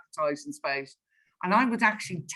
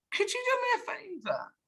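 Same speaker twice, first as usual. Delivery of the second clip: soft, very high coaxing question; gently wheedling, pitched right up; coaxing request; 13 semitones higher, 6 dB quieter than usual. Retold or imitated. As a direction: lift it high and light to ask a favour. Retold sales pitch, coaxing the director.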